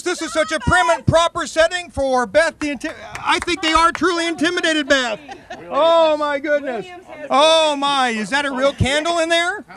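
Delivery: ordinary delivery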